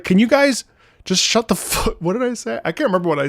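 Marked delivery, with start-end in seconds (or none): 0.0-2.0 mock exasperated yell, pitched high; retold yelling. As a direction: re-enact a fed-up outburst, cut short. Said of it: "And I yelled at them".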